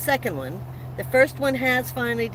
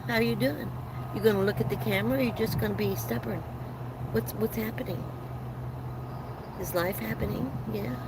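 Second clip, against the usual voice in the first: soft pet talk; 7 dB quieter than usual. Real, not acted. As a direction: murmur to the dog, much softer. Talking softly to her dog.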